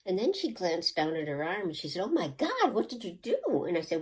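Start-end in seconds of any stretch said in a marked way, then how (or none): 2.0-3.6 shocked. Retold or imitated. Friend sees her bruised arm.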